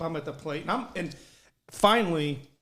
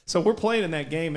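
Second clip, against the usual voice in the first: ordinary delivery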